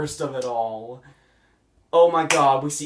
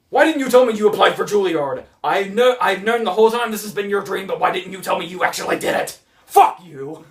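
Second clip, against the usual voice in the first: loud, higher-pitched accusing rant; mock breakup outrage; 5 semitones higher, 6 dB louder than usual. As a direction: act out a hammed-up lovers' quarrel. Acting out the film's breakup quarrel.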